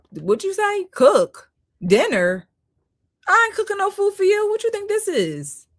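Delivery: 高声不屑地模仿女人拒绝做饭; indignant mock woman. Woman mocking a request to cook.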